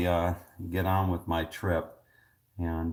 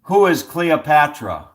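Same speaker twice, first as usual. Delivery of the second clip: loud, slow, higher quiz answer; deliberate, raised contestant's reply; calling out answer; 8 semitones higher, 10 dB louder than usual. Real, not acted. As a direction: project it slowly for the machine to catch.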